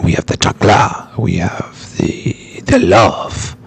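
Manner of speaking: put-on low, dramatic telenovela Mexican accent, drifting French; hammed-up, low Mexican accent. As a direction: play a low-voiced Latin soap star with a bad accent. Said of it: "trying to do my telenovela".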